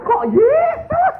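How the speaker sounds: high drawn-out disbelieving cry; shocked outburst. Retold or imitated. Husband shocked that his wife called out to Jesus. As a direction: stretch it out in shock.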